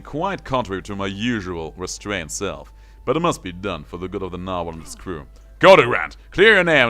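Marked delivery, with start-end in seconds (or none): 5.6-6.9 loud, urgent command; shouted order. Acted. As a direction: bark the order at him.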